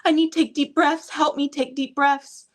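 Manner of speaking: a mom voicing her toddler asking for help; imitating her little daughter. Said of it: "she'll look at me and she'll go".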